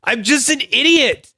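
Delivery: high-pitched comic whine; squeaky mock anguish; mock self-berating wail. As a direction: wail it in hammed-up despair. Mock outburst at being corrected.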